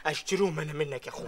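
ordinary talk

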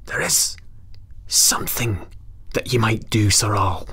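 hushed breathy secret-telling; goblin whisper. Acted. Goblin confiding to the Earl, in a whisper. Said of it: "whispered the goblin".

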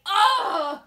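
loud exasperated "ugh"; disgusted groan. Acted. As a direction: groan as if dying of embarrassment.

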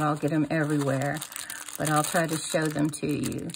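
ordinary delivery

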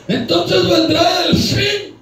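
slow, high, loud proclamation; slow dramatic emphasis. Acted. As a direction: proclaim each word slowly. Preacher quotes verse dramatically.

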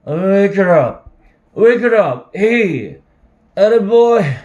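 put-on drunken shout.

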